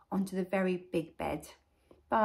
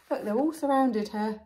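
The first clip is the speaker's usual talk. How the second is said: usual speaking voice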